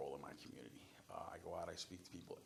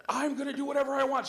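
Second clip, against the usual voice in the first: high, very loud imitated outburst; defiant yelling; 14 dB louder than usual. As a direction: scream it in open defiance. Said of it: "starts screaming, yelling".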